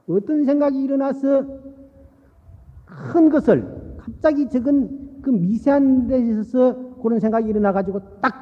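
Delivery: usual speaking voice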